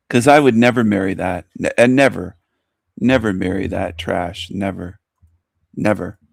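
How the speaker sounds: normal talking voice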